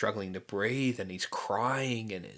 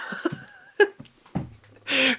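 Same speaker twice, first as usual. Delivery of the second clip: loud high laugh; 10 dB louder than usual. Real, not acted.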